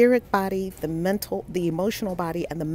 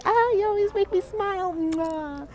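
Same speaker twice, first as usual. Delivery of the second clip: much higher, thrilled thank-you; delighted squeal; 14 semitones higher than usual. Real, not acted. Host reacting to a viewer's kind comment, delighted squeal.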